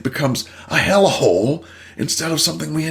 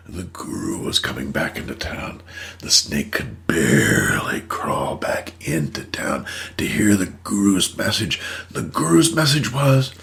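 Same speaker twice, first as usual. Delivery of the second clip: softer, whispered parable narration; hushed storytelling; 7 semitones lower, 3 dB quieter than usual. A narrator's reading. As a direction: lean in and tell the fable quietly. Tells the snake parable softly.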